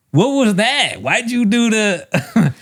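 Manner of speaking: high, drawn-out baffled questioning; mock disbelief. Comic demanding an explanation from the audience, high mock disbelief.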